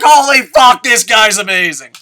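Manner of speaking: high, loud amazed shout; a loud thrilled exclamation; excited. Retold or imitated. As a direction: cheer like a fan marking out. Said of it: "And I'm like".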